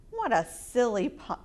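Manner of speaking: plain reading voice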